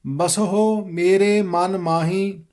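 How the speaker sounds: slow, measured recitation of scripture; careful model reading. Shown as a demonstration. Teacher modelling the shabad for students, slow careful recitation. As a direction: read it out slowly, every word distinct. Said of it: "ਫਿਰ ਦੇਖੋ".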